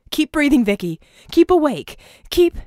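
high, loud desperate commands; urgent shouting. A narrator's reading. Red shouting at Vicky to keep her breathing, high and loud. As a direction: yell at her to stay awake.

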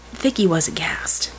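plain reading voice

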